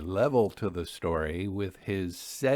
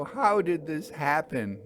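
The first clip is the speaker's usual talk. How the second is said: higher, pleading distress; distressed question; 6 semitones higher than usual. Acted. Narrator asks railwaymen, stunned and distressed. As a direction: ask in horrified disbelief.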